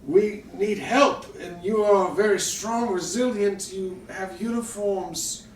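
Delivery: put-on high, urgent old refugee voice; frightened old man begging soldiers; pleading old man.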